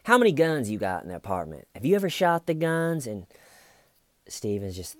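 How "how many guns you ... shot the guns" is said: grilling. Retold or imitated. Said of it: "starts grilling Stiven about his guns".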